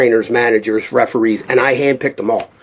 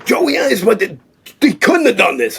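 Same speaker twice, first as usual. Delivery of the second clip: retold scream; 8 semitones higher than usual. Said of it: "Stallone starts screaming".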